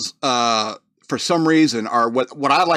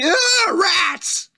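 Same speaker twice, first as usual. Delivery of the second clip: shrieked "Ah, rats!" much higher, like spotting vermin; disgusted shriek. Pretend. City folk seeing a rat, a mock high shriek. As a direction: jump up an octave in mock horror at a rat.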